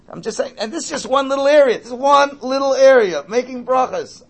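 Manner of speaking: emphatic. Real, not acted. Preacher stresses one small area.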